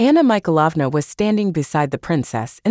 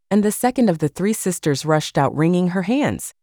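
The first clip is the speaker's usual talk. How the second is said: ordinary delivery, nothing marked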